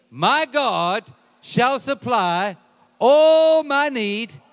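loud, high, slow declaration; full-force preaching. Acted. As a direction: proclaim it with long, drawn-out emphatic words.

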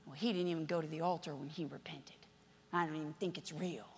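low, quiet sceptical grumble; mock doubting church member.

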